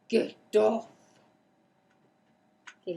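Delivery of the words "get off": louder snap at the cat; sharp scold. Real, not acted. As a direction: bark the order.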